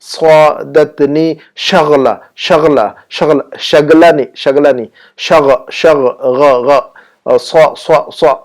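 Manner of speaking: slow سعادتنی شغلہ, then isolated غا and heavy صا; pronunciation drill. Shown as a demonstration. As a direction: chop شغلہ into شغ and غا, repeating each. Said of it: "صاد کی آواز کو نکالیے".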